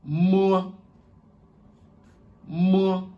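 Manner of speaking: "Moa" called out high and loud; Chinese letter M. Shown as a demonstration. Teacher drilling the Chinese letter name for students, high and clear. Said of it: "Le M".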